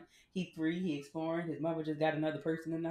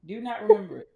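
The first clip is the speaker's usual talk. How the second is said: freaked out; 2 semitones higher, 16 dB louder than usual. Real, not acted. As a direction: tell it still rattled. Recounting waking in fright.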